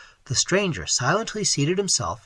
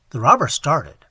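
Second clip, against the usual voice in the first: ordinary delivery, nothing marked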